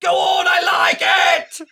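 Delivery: high, slow, shouted British taunt; mock boxer shout. Shouting the boxer's taunt at his friend, high and mocking. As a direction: bellow it like a fighter wanting pain.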